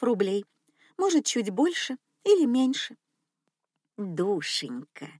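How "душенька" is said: low, soft, slow cooing; cooing old lady. Acted. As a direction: coo it like a sweet rich old lady. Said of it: "проворковала старушка".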